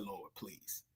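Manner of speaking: tiny, low, fading plea; retold tearful plea. Praying outside after crying, barely audible tearful plea. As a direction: plead softly, as if cried out. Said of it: "I was crying".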